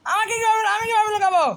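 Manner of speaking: high, loud panicked cry; panic, nowhere to hide. Real, not acted. Granny coming, panicked.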